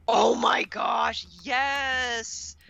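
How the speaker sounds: slow oh my gosh, then a high yes; thrilled discovery, savour it; delighted. Real, not acted. Finding the book for the listeners, slow delighted cry.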